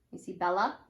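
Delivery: plain reading voice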